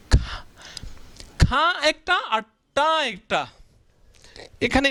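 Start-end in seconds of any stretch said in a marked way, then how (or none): 0.1-3.4 খা and টা said apart, slowly; syllables split apart. Shown as a demonstration. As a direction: break the word into its two syllables. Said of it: "দুইটা টুকরো হয়ে গেছে". (খা আর টা আলাদা করে ধীরে বলা)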